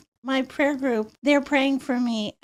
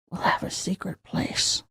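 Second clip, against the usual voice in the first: bisikan pelan tanpa suara, dekat telinga; whisper; 7 semitones lower, 6 dB quieter than usual. Retold or imitated. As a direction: bisikan rahasia menirukan Sean Connery. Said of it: "Sean Connery whispers in my ear".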